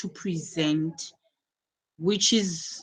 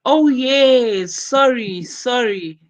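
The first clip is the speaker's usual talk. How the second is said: sudden loud, high oh yes, then sorry falling back down; surprised apology; 5 semitones higher, 8 dB louder, slower than usual. Real, not acted. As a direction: caught out, apologising right away. Teacher realises she got the name wrong.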